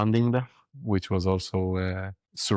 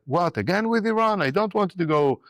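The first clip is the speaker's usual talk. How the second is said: put-on child's voice; 10 semitones higher than usual. Six-year-old child voiced in story.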